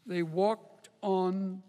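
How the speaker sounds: "They walked on together" drawn out, very slow; savoured slowly. Shown as a demonstration. Preacher savouring the verse, reading it slowly. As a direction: let each word linger. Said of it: "I love the way that reads".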